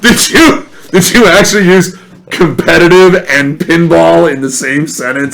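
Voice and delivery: high, loud disbelief through laughter; mock him, laughing; gleeful incredulous ribbing. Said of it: "I said".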